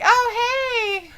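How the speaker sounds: a lightly faked pained cry; mock cry. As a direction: play the beaten wrestler crying out. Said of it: "He's like".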